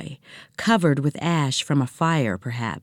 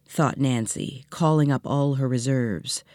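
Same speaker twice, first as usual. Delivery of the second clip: ordinary delivery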